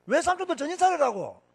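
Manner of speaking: 높은 음으로 꾸짖는 재연; 외삼촌들을 나무라듯 높여 말하기. Retold or imitated. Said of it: "내가 그렇게 고함을 질러도요". (높은 목소리로 호통치기)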